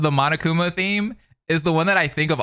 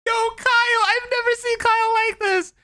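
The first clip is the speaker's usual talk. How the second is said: hyped. Real, not acted. Hyped by Kyle's outburst.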